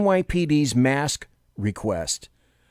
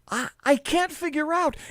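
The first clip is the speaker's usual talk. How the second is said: mock confused voice; 13 semitones higher, 4 dB quieter than usual. Mocking confused New Yorkers.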